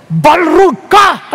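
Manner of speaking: slow, drawn-out yell of an insult; hammed-up slow shout of an insult; mock shouted insult. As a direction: bellow the name-calling slowly. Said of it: "hindi ganun".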